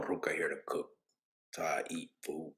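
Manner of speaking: unvoiced whispered aside. Real, not acted. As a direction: whisper it to yourself. Muttered aside.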